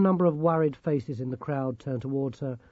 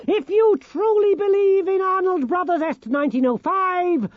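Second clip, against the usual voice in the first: high, booming, rousing gnome voice; rally the crowd like a tiny prophet; zealous gnome leader; 8 dB louder than usual. Acted.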